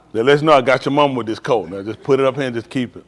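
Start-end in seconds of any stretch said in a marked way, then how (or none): none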